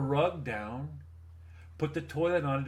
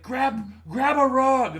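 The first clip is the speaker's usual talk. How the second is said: urgent order; 9 semitones higher, 9 dB louder, slower than usual. Retold or imitated. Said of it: "I was like".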